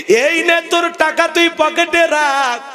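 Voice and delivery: pleading it out like a wounded mother; voiced grieving mother. Mother to her rich son, lightly voiced hurt mother.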